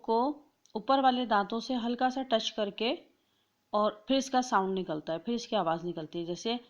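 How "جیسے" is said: ordinary talk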